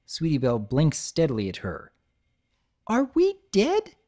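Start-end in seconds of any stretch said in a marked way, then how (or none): 2.9-4.0 high, slow, dazed filly voice; dazed squeaky voice. Acted. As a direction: ask it in a dazed daze.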